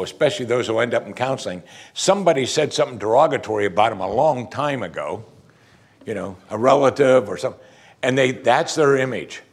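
ordinary talk, nothing marked

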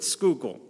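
Schuylkill said SKOO-kul; local pronunciation. Shown as a demonstration. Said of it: "scooter, but Schuylkill".

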